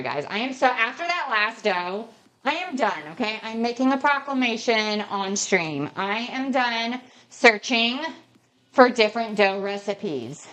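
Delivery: ordinary delivery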